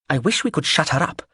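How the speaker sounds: ordinary delivery, nothing marked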